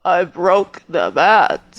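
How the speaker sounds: grating villain impression, slow and drawn out; put-on villain voice with deliberate, stretched words; Bane. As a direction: do a slow, mannered supervillain voice. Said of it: "Can you do an impression?".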